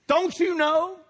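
high, loud shouted demand; old Saul raging. Acted. Saul confronting Jonathan, high and shouting. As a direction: bark it at your son. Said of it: "Listen to old Saul".

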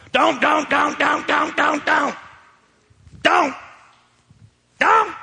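high, rapid-fire mock scolding; religious nag. Preacher mimicking religious people who forbid everything, mock nagging. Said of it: "What do religious people say?".

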